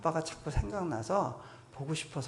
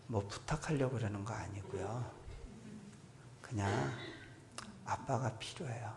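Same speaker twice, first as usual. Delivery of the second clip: 부드럽고 작게, 속삭이듯 간구; 엎드려 조용히 아버지께 매달리듯; 간절한 기도; 8 dB quieter than usual. Retold or imitated. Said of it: "이렇게 기도했어요". (하나님께 엎드려 간구하는 목소리, 작고 간절하게)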